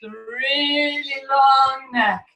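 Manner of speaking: very slow, elongated words; slow, lengthened vowels; stretched out. Shown as a demonstration. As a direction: draw the words out like a long neck. Demonstrating a stretched snake.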